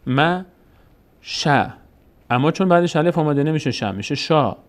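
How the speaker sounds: usual speaking voice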